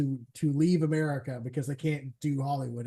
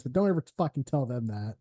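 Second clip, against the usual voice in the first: ordinary delivery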